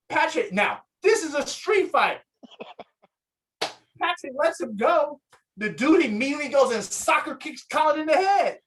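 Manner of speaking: normal talking voice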